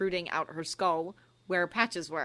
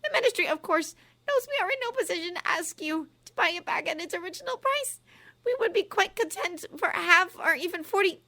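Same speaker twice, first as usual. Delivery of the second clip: high official voice; 12 semitones higher, 5 dB louder than usual. Acted. Umbridge bargaining.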